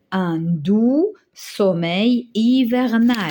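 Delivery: dictating to the class, slow and clear; slow dictation. Shown as a demonstration. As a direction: dictate each word slowly. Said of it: "qu'est-ce qu'on va dire".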